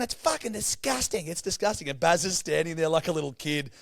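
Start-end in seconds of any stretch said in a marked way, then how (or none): 0.0-1.9 imitating her, much higher and hammed-up; high nagging voice scolding at the ice bath; mocking a bossy woman telling them to shower; mock shrill woman. Woman quoted.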